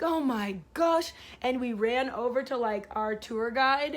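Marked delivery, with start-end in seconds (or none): 0.0-1.1 higher, slower "Oh my gosh"; relieved exclamation. Retold or imitated. Spotting her tour bus at last, relieved "Oh my gosh". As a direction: sound it out in relief. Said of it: "and I was like".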